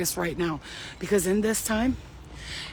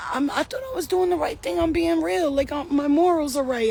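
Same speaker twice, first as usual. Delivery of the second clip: mock whiny; 6 semitones higher than usual. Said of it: "the real ones are in the corner just like".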